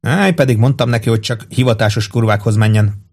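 ordinary delivery, nothing marked

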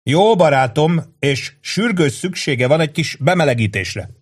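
rowdy barroom buddy; boisterous Angelo. A narrator's reading. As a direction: hail a friend across a packed bar. Angelo voiced.

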